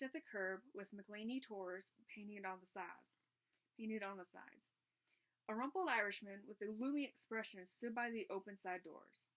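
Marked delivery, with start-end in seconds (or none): none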